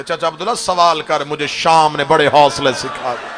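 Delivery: starts low then swells into a loud wailing cry; grief building to a cry. Acted. Sajjad tells his uncle of Sham, building anguished cry. Grieving recitation rising to cry.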